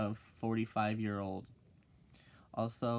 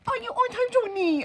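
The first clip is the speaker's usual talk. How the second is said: much higher and louder squeaky voice; Chinese lady impression; 10 dB louder than usual. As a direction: imitate the little teacher, shrill and loud. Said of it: "this freaking little Chinese lady".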